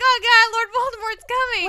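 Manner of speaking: high, loud, hammed-up alarm; mock panic. Baby in crib sees the Dark Lord coming, mock panic. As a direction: squeal it as a comic cry of dread.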